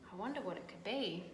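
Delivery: ordinary delivery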